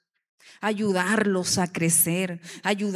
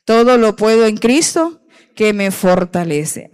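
loud declaration; 11 dB louder than usual. Acted. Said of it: "diga conmigo".

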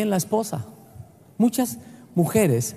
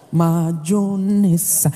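drawn-out joke word; 3 semitones lower, much slower than usual. Pretend.

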